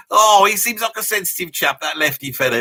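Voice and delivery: hammed-up female admiration; admiring ladies. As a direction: imitate ladies cooing over a sensitive chap. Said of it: "the ladies go".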